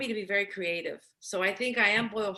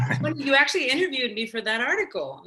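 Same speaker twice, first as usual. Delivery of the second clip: talking through a real laugh; amused, words broken by laughter; 5 semitones higher, 6 dB louder than usual. Real, not acted. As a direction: laugh as the memory comes back, louder. Remembers being interviewed, amused.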